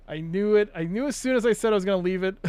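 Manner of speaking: quick frustrated outburst at a mistake; exasperated I knew it. Real, not acted. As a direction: blurt it out, annoyed at yourself. Catching his own mistake.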